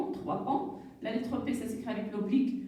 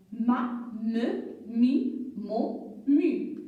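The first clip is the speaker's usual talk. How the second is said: syllable drill; 2 semitones lower, 3 dB louder, much slower than usual. Shown as a demonstration. Teacher drilling syllables, "ma, me, mi, mo, mu" spaced out. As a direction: chant out each syllable separately. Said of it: "la consonne avec la voyelle".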